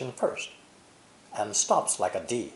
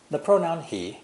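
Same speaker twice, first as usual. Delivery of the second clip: ordinary delivery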